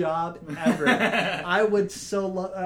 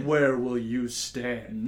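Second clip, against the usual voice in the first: low, very slow trailer voice; ominous trailer question; movie trailer voice; 4 semitones lower, much slower than usual. Pretend. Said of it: "the in a world guy".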